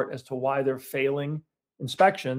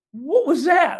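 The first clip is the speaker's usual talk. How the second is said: higher, louder stunned question; salesman's shock; 12 semitones higher, 6 dB louder, slower than usual. Retold or imitated. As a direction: sales guy Gary blurts it out in disbelief. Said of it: "He's like".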